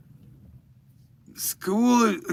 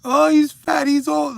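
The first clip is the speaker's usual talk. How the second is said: high, loud mocking heckler; mock insult voice; 12 semitones higher, 5 dB louder, slower than usual. Mimicking a commenter, high and loud mocking. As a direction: sneer it like a troll in the comments.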